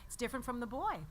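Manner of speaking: normal talking voice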